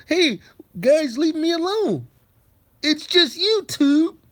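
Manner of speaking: high, loud pleading mockery; mocking whine. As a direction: beg to be left alone, mockingly. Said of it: "There is no".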